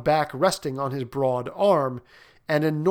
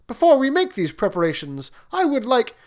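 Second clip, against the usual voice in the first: higher, pleading prince; 9 semitones higher, 4 dB louder than usual. Acted. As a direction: interrupt the king politely. Prince protests, cut off.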